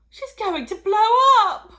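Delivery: high loud panicked wail. Acted. Missus Cranky wailing at her swelling mother. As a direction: wail it out in terror. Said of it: "Missus Cranky wailed".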